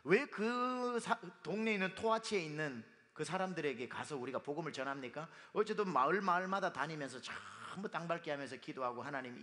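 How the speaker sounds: usual speaking voice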